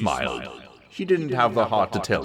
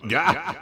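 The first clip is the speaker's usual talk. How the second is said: startled bleat; 10 semitones higher than usual. Acted. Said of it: "she bleated".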